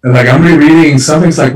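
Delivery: ordinary talk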